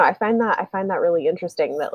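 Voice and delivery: normal talking voice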